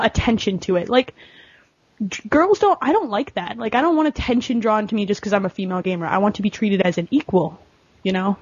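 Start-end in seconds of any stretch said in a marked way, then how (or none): none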